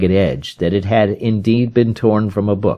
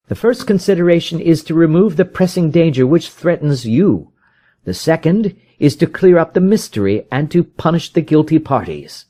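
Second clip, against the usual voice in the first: higher, pressing detective's voice; urgent Holmes; 9 semitones higher than usual. A narrator's reading. Holmes urges the frightened visitor, higher and pressing. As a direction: rouse a despairing man to action.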